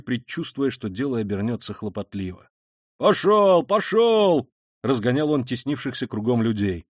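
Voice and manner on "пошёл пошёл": high, loud dispersing shout; shouted order. A narrator's reading. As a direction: shout the crowd back. Policeman drives the crowd away. (громко разгонять толпу)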